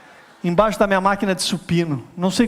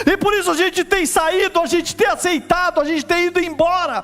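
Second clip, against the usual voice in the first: preaching at full force; 9 semitones higher than usual. Real, not acted. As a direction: rouse the congregation, building to a cry.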